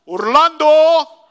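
slow, loud, stern name call; stern mom calling out. Retold or imitated. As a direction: drag out the name like an angry mom. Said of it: "with a different tone of voice".

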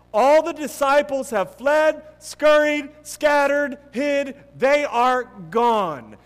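fervent preacher's climax; preaching at full force. Acted. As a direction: drive it home from the pulpit, then let it land slowly. Preacher building on the disciples' flight.

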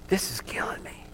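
whispered, breaking with pain; a father whispering to his son in anguish. Retold or imitated. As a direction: whisper it like it hurts to say.

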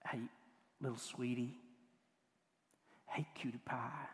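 soft, low, tender endearment; almost whisper a pet name; affectionate. Acted. Pet names for a small child, softly affectionate. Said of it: "is an affectionate term".

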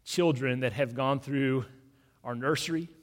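soft, low, unhurried prayer voice; hushed prayer. Acted. Pastor praying over a grieving family, hushed and low. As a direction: pray gently and reverently over the congregation.

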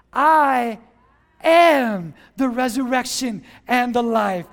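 loud, weighty I AM, each word set apart; slow, forceful proclamation with a pause after I; emphatic declaration. Retold or imitated. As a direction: land hard on "I" and "am". Preacher declares Jesus' words emphatically.

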